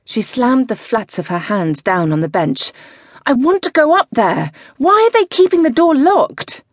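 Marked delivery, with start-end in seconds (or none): 3.3-6.6 high, loud and desperate; nervous rider pleading with her coach, high and loud; frantic demand. Acted. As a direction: demand it, nerves snapping. Zoe frantic before the race.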